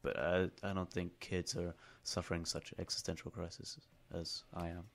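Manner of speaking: ordinary talk, nothing marked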